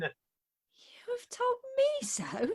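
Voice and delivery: slow, breathy stunned whisper; astonished whisper. Acted. Bride stunned by the suitor's claim, slow whisper. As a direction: breathe it in disbelief.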